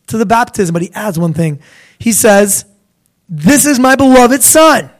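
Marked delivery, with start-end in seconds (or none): 3.3-4.9 higher, louder ringing declaration; the Father's proclamation. Acted. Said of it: "the Father comes in".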